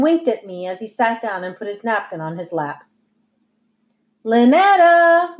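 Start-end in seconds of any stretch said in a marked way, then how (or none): 4.2-5.4 high, loud, drawn-out name call; mother's lilting summons; singsong call. A narrator's reading. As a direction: sing it out to someone in another room. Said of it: "my mother sang out".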